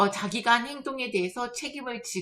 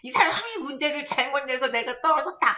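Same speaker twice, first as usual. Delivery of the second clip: higher-pitched indignant blame; mock cursing; 4 semitones higher than usual. Failed candidate blaming the question writer, mock cursing. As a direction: act out a griping complaint. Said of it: "막 욕하면서".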